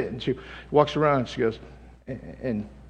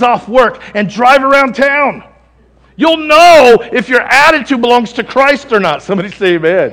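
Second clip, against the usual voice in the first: loud, raised, driving sermon delivery; full-force preaching; 6 semitones higher, 11 dB louder than usual. Real, not acted. Preacher urging the congregation to surrender to God, full-force preaching. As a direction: build to a high, loud call for amen.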